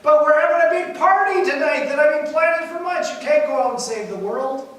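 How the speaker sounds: high, loud protest, easing slightly at the end; mock indignant yell. Wife protests husband leaving to save the world, mock yelling. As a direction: act out a wife hollering her objection. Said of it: "She yells back".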